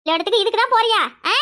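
ordinary delivery

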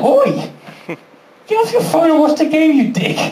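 high, indignant heckle; mock indignant telling-off. Footballers having a go at a kid texting in the crowd, mock-angry. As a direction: tell someone off, voice pitched well up.

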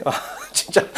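soft laughing exclamation; amused disbelief. Real, not acted. Teacher still tickled by the student's question, laughing softly. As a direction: let a quiet laugh break the words.

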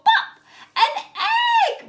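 high, loud delighted cry; excited pop. Acted. Teacher revealing an egg to the children, high and loud. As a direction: burst out with the find. Lifting the flap.

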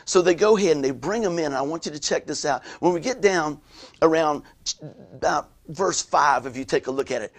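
usual speaking voice

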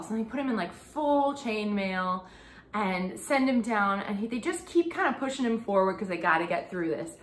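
usual speaking voice

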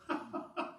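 soft little chuckle; peaceful laugh. Real, not acted. Preacher assured that God's got it, quiet relieved laugh. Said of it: "I can laugh with peace".

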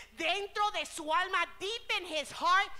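preacher swelling to a climax; building preaching. Acted. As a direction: build the sermon to its peak. Preacher addressing congregation.

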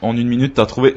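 normal talking voice

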